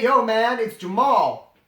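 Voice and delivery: normal talking voice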